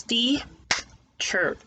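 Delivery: "teacher" stretched into two slow claps, "tea" and "cher"; teacher clapping the syllables for pupils; very slow beats. Shown as a demonstration. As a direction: drag "teacher" out beat by beat. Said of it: "Now let's have some beat".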